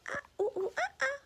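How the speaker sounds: high ooh ooh ah ah; monkey calls. Shown as a demonstration. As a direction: hoot like a monkey. Parent demos monkey sound.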